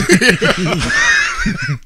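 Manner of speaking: high shrieking laughter. Real, not acted. Big laugh burst.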